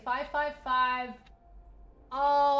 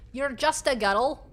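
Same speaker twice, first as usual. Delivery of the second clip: higher, playful Scottish "Guttle"; Scottish accent; 4 semitones higher, 4 dB louder than usual. Pretend. Streamer repeating a favourite word, Scottish accent. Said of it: "it's so fun. I have to say it".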